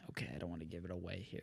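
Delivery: quick whispered mumble to himself; secretive aside. Real, not acted. Co-host avoiding giving his award away, whispered aside. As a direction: whisper it fast, nearly inaudible.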